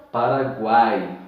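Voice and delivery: Paraguai slowly with u, a and i each sounded. Shown as a demonstration. Teacher sounding out every vowel of Paraguai. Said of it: "pronuncia o A e pronuncia o I".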